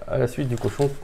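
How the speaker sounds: normal talking voice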